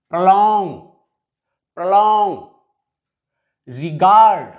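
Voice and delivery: "prolong" and "regard" with long, strong second syllables; proLONG, reGARD, spaced out and punched; stress on last syllable. Shown as a demonstration. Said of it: "stress is on the last syllable".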